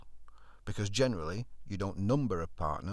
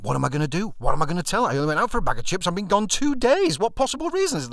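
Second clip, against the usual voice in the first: rising panic, louder and higher up to a yelp; panicking; 9 semitones higher, 9 dB louder, faster than usual. Retold or imitated. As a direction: spiral into frantic worry. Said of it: "and panicking".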